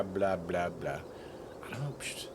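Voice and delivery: ordinary talk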